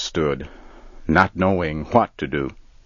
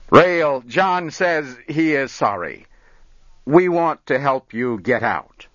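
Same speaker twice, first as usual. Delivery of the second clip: calling out; 8 semitones higher than usual. Acted. Barry calling down to Rail, high and pleading. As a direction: call down to him, pleading.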